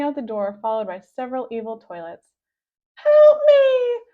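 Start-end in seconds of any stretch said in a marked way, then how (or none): 2.9-4.0 high, loud panicked scream; terrified teacher screaming for help; frantic cry for help. A narrator's reading. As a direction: shriek for help as they close in. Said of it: "she cried".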